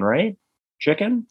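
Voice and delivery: rising high, tentative query; awkward retold question. Larry asking the guy about chicken, awkwardly questioning. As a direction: imitate an awkward check, pitch climbing on each word.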